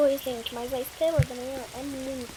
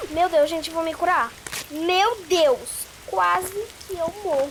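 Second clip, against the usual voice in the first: loud, higher cries of real fright; panicked relief; 4 semitones higher, 13 dB louder than usual. Real, not acted. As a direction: blurt it out, still shaking from the near death. Just escaped death in game.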